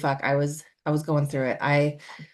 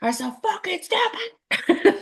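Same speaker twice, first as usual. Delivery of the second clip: very high, drawn-out mock exasperation with a laugh; hammed-up high voice imitating funny cow videos; mock video narrator; 12 semitones higher, slower than usual. Quoting the cow videos to a friend, high mocking voice. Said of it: "cow ones when they're like".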